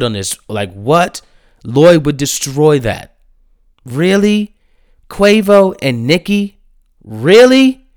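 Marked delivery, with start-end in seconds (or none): 3.8-7.9 high, rising disbelieving questions; real incredulity. Real, not acted. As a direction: question it in utter disbelief, pitch climbing. Disbelief at the remix's guest choices.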